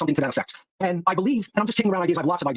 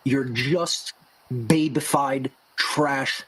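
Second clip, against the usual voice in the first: very slow, low, drawn-out words; mock-pleasant; 5 semitones lower, much slower than usual. Shown as a demonstration. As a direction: say each insult slowly and deliberately, in a lowered voice. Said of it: "it's being said so pleasantly".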